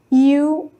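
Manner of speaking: ordinary talk, nothing marked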